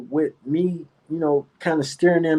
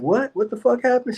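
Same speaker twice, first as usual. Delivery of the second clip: higher, mock-shocked retelling of his reaction; stunned disbelief; 10 semitones higher than usual. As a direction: replay the moment you were floored. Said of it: "I'm like".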